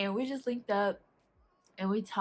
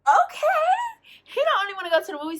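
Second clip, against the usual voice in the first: excited squeal; 11 semitones higher, 10 dB louder, much faster than usual. Retold or imitated. Thrilled he'll also buy food.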